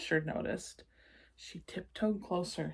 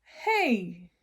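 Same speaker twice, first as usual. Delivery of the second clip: cheered; 3 semitones higher, 6 dB louder than usual. Acted. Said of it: "I cheered".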